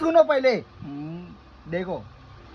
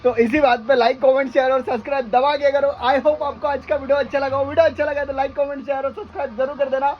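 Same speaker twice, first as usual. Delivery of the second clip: bright announcer-style goodbye; cheerful outro asking for likes; vlog outro; 4 semitones higher than usual. Acted.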